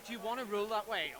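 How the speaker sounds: usual speaking voice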